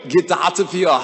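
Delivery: a jokey Austrian Schwarzenegger bark; Schwarzenegger accent. Retold or imitated. Teacher jokes about accents, mock Austrian Schwarzenegger accent. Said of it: "we'll sound like Schwarzenegger".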